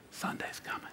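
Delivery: barely audible, hushed whisper. Shown as a demonstration. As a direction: whisper it like a secret not yet to be told. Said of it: "it's a whisper".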